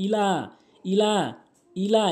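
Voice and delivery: ইলা with the লা held one alif; long vowel. Shown as a demonstration. Teacher drills the stretched ইলা. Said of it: "এভাবে টানবেন".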